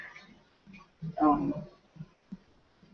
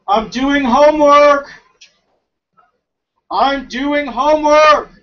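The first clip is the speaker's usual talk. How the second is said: loud, higher, slow and drawn out; annoyed shout; 6 semitones higher, 9 dB louder, slower than usual. Real, not acted. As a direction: yell through the wall, irritated. Yelling back to mom offscreen.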